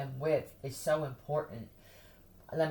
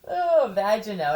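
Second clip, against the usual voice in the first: Vagino stretched out louder with relish; relishing the name; 4 semitones higher, 8 dB louder than usual. Shown as a demonstration. Savoring her joke name for a mobster, relishing. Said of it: "Vagino sounds like a".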